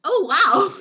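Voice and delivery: flattered and giddy; delighted. Acted. Alien flattered as important.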